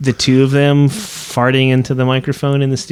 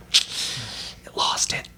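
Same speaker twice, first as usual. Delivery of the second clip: whispering; 8 dB quieter, much slower than usual. Retold or imitated. Quoting his own reaction.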